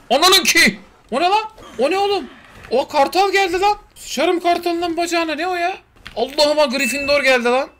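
high, loud panicked yell. Real, not acted. To his viewers as an eagle swoops in: high panicked yelling. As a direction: shriek in real alarm at the sudden attack.

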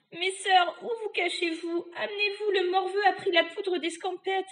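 high-pitched shout to sisters; witch crying out. Acted. Witch to her missing sisters, high shouted summons. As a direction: call urgently through the house. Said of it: "elle cria".

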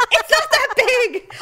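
high, loud, slow protest; exasperated scolding. Retold or imitated. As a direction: imitate an annoyed driver. Driver scolds her overreaction.

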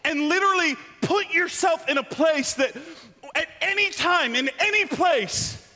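normal talking voice

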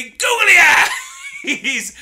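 high, drawn-out laugh-yell; cackling with delight; laughing shout. Real, not acted. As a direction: shriek it through laughter. Laughing at the character's face.

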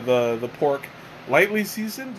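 ordinary talk, nothing marked

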